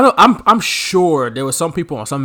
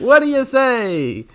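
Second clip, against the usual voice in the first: referee impression; 6 semitones higher, slower than usual. Imitating referee on mic.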